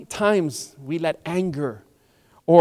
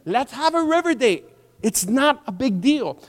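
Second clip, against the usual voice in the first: coaxing servants; 7 semitones higher than usual. Acted. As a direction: make it sound like no trouble at all. Servants coaxing commander to the river.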